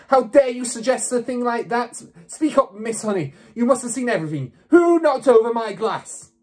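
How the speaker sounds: high-pitched angry demand; indignant roar. A narrator's reading. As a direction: demand answers like an outraged headmistress. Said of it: "roared the Trunchbull".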